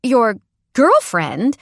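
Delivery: high slow squeaky question; giddy squeaky disbelief; squeak. Acted. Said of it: "I squeak and start giggling".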